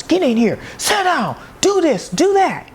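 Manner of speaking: high, sharp commanding parent; a strict parent ordering kids around; strict parent orders. Retold or imitated. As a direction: bark orders like an old-school parent. Old-school parents barking orders.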